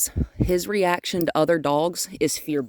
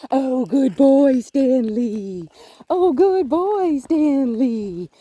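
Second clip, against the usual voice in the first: high praise voice; 6 semitones higher, 5 dB louder, much slower than usual. Real, not acted. Slow high praise to dog.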